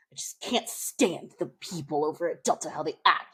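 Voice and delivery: a whispered aside about the other airline; gossipy whisper. Retold or imitated. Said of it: "she's like".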